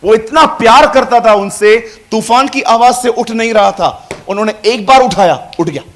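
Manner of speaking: loud, high, rousing sermon; full-voiced pulpit fervour; fervent preaching. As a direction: preach it to stir the crowd.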